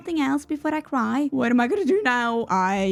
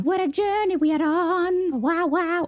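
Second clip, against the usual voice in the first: mock grand; 6 semitones higher, slower than usual. Dramatic mock-grand remark, high and slow.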